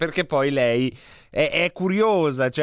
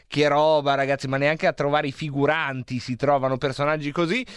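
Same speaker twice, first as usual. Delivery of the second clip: usual speaking voice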